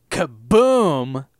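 loud, booming kaboom; explosion noise. Retold or imitated. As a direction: burst out with it like a blast. Imitates the house exploding.

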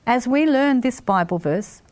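usual speaking voice

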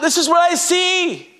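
high-pitched mild call; lightly acted cry of wonder. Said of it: "The eyes are calling out".

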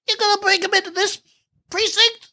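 very high, silly cop voice; Muppet-like captain. Acted. Host mocking the police captain, Muppet-like impression. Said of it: "he sounded like a Muppet".

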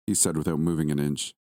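usual speaking voice

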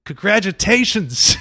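slow, loud, laughing congratulations; drawn-out mock congratulation. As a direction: savor the word like a trophy. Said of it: "I like that".

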